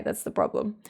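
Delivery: usual speaking voice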